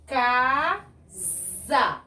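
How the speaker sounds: "Casa" by syllables, the s a long buzzing z; som de Z. Shown as a demonstration. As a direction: draw out "Casa" as ca-zza. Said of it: "Olha o som". ("Casa" silabado, com Z prolongado)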